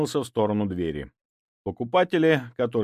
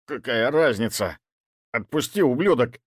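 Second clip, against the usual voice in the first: angry hiss through teeth; 4 semitones higher, 5 dB louder than usual. A narrator's reading. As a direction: spit it out angrily. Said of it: "зло процедил Бритоголовый". (злобно, сквозь зубы)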